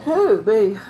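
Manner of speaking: yawning. Real, not acted. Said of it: "I keep yawning".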